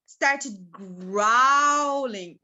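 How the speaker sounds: loud, very slow, stretched-out growl on the word; drawn-out growling. A narrator's reading. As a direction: stretch GROWLING out like a rumbling tummy. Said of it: "the loudest noise you could hear".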